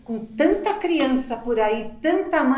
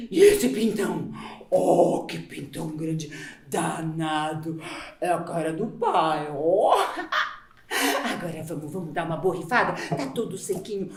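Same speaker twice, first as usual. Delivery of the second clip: much lower teasing growl; mock macho voice; 11 semitones lower than usual. Mother teasing the baby boy, low mock gruff voice. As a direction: tease him in a low gruff voice.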